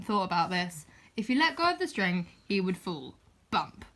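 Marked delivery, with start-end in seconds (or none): none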